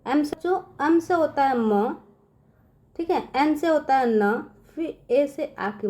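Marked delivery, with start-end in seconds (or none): none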